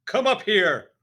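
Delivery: high, very loud command; bellowing headmistress; shouted order. A narrator's reading. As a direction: shout him up to the stage. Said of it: "the Trunchbull shouted".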